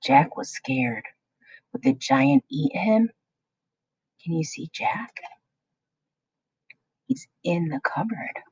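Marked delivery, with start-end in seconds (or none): 4.2-8.4 put-on secretive whisper to the children. A narrator's reading. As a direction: lean in and whisper where Jack hides. Narrator asides to young listeners.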